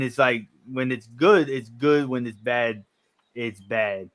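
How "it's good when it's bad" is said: ordinary talk, nothing marked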